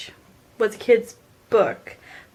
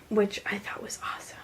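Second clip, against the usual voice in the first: whispering; 11 dB quieter, faster than usual. Real, not acted. Aside after describing the book.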